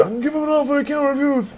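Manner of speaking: high, strained, hurried quote like straining on the toilet; straining impression. Host showing how this quote must be done, strained and high. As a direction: squeeze it out like you're on the toilet. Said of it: "You do it like you're taking a shit".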